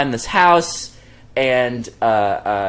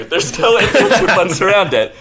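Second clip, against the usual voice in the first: high, raised-voice protest; emphatic self-defence; 11 semitones higher, 4 dB louder than usual. Real, not acted. Storyteller defending himself to co-hosts, high emphatic protest. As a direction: plead your case loudly, pitched way up.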